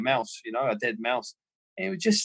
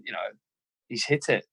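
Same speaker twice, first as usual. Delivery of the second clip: ordinary talk, nothing marked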